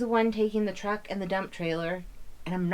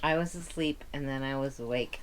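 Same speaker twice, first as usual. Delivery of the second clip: usual speaking voice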